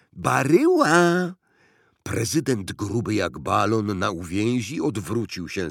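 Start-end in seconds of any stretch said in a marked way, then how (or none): 0.1-1.3 high, loud, drawn-out call. A narrator's reading. Boy knocks at the president's window, calling out. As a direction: holler the name through the window. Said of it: "zapukał do okna i zawołał".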